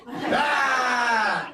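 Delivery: long, loud, high "aah"; hammed-up trucker holler; trucker yell. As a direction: let out a drawn-out yell.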